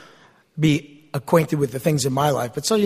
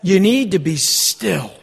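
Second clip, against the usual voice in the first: slow, deliberate You need to be still; slow emphasis; slower than usual. Shown as a demonstration. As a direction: lay each word down slowly. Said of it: "Modern translation".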